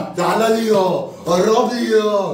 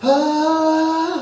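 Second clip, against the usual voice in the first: sung groan; 9 semitones higher, much slower than usual. Acted.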